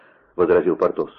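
usual speaking voice

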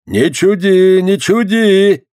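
put-on high, loud old man's scolding; scolding shout. A narrator's reading. Old man to playful girl, scolding shout. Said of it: "прикрикнул на неё старик".

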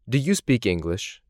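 usual speaking voice

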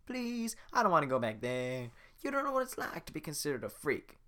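begging Donkey. Acted.

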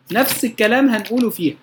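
normal talking voice

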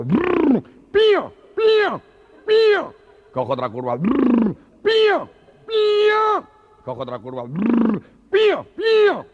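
piercing very high shrieks; shrieked pío. Acted. Gangoso squealing on each motorbike curve, piercing shrieks. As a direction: squeal at every bend.

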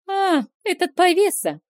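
loud, high scoff; scornful exclamation. Acted. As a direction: scoff at him loudly. Vilma scoffs at the rake.

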